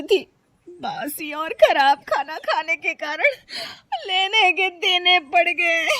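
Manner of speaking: high, loud, whining lament; pained, self-pitying wail; tearful confession. Acted. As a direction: blurt out your woes, close to crying. Confesses while suffering stomach pain.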